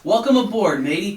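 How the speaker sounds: high, loud hearty sailor welcome; hearty shout. A narrator's reading. Captain welcomes his friend aboard, hearty loud shout. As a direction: boom out a jolly welcome. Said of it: "Captain Dalbert shouted".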